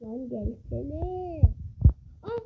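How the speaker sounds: slow, soft drawn-out coax; quiet slow coaxing to the monster; coaxing call. Real, not acted. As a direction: lure it in quietly, slowly.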